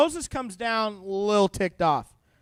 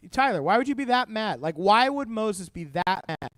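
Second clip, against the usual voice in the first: usual speaking voice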